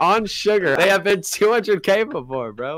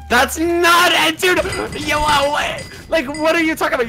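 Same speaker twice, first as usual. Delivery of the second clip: outraged disbelief; 12 semitones higher than usual. Real, not acted. Player rejecting a ten mil offer, outraged high protest.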